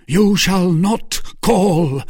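loud, high, slow refusal; forceful forbidding. A narrator's reading. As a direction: forbid him with heavy emphasis. Felton blocks the Duke.

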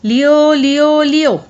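high, loud, drawn-out calls; ringing repeated holler; loud calling out. A narrator's reading. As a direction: call the dog from afar, stretching each cry. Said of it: "بیٹھے بیٹھے ہی زور سے آواز لگائی".

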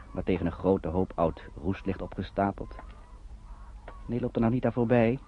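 ordinary talk

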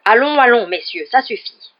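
booming call to order, starting very loud; powerful voice. A narrator's reading. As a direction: break up a fight with a commanding shout. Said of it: "une voix plus puissante que les autres". (voix puissante, autoritaire)

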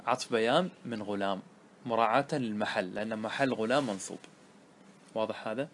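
ordinary talk

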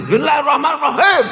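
quick loud recitation. Retold or imitated. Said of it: "Pas tadi".

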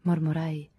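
ordinary delivery

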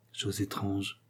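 musing to himself. A narrator's reading. Captain reflecting on the dead man's fortune, musing quietly. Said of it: "dit-il plutôt à lui-même".

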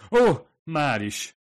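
catching the forgotten order; startled realization of a slip; sudden realization. A narrator's reading. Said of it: "eszmélt rá Kadzu a hibára".

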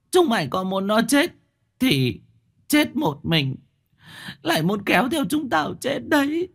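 very high, wailing indignation; hysterical outrage. A narrator's reading. Widow rages at the family, begs master to seal him, hysterical. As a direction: berate them in a high, frantic pleading voice.

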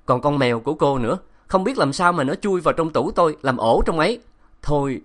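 high, loud exasperated scolding; shouting professor. A narrator's reading. Professor yelling at the runaway girl, loud and exasperated. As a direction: scold her hard, fed up with searching. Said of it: "giáo sư La Nghị hét to".

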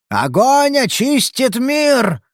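slow, high, roaring madman; wild unhinged ranting. Acted. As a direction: bellow it like a crazed prophet. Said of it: "орал безумец".

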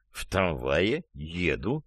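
soft, low, very slow reply; drawn-out, low, tentative statement; meek answer. Acted. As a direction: offer it slowly and meekly. Dazed man answers simply.

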